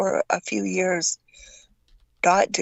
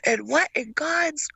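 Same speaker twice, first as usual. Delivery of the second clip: drill instructor voice; 6 semitones higher, slower than usual. Retold or imitated. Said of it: "he goes".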